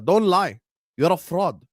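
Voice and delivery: normal talking voice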